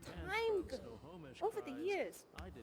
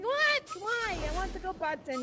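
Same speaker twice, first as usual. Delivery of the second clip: indignant whine; 5 semitones higher, 5 dB louder than usual. Retold or imitated. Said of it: "I'm like".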